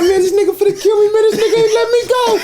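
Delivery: laughing shout. Real, not acted. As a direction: shriek it through laughter. Retold panic, laughing.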